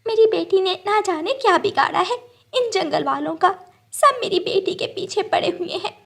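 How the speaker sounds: high, distressed mother's voice; worried mother. Acted. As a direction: lament that everyone chases her daughter.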